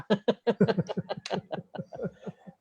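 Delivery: a brief high-pitched laugh. Real, not acted. Speaker laughing after warning the audience about racism, high laugh. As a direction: laugh at your own warning. Laughing at his own joke.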